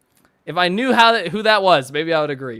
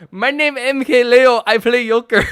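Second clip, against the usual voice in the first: loud, higher mock-Mexican accent; 6 semitones higher, 8 dB louder than usual. Streamer retells an in-joke with Thomas, Mexican accent. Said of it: "He's an extremely Mexican man, so I'm, I'm allowed to say this".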